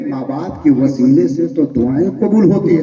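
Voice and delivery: ordinary talk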